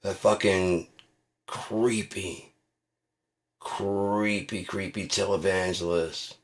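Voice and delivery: ordinary talk, nothing marked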